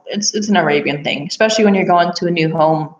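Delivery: normal talking voice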